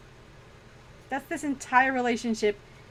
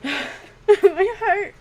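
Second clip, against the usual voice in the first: overcome; 9 semitones higher, 8 dB louder than usual. Real, not acted. Overwhelmed by cuteness.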